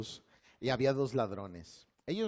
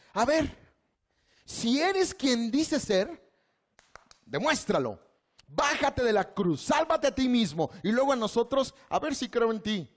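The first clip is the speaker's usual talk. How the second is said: mocking, challenging, higher and louder; taunting thief; 9 semitones higher, 5 dB louder than usual. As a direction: dare him to come down off the cross. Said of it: "esa era su postura". (ladrón burlón, desafiante)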